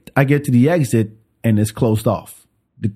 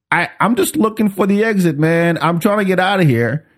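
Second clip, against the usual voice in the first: pleading; 6 semitones higher than usual. Retold or imitated. Pleads with the startled cops.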